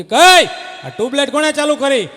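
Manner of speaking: loud, high angry yell at the wife; angry shout. Retold or imitated. Police officer shouting at wife over the tubelights, furious shout. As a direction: bark it out like a new police officer.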